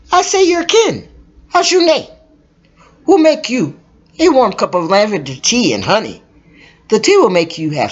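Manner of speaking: high, eager voice; high character voice. Acted. Woman welcoming little kin to tea, high-voiced. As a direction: speak up high to welcome the child.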